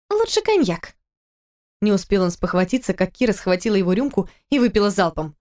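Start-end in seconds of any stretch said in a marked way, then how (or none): 0.1-1.0 put-on very high tipsy girl; cheeky drunk demand.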